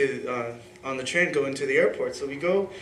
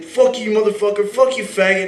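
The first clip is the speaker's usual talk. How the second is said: imitating a brawler, raised and louder; angry cursing; 7 semitones higher, 5 dB louder than usual. Friend cursing the guy he's hitting, mock rage. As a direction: hurl the insults, mock-furious. Acting man swearing in fight.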